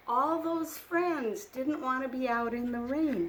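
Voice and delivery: plain reading voice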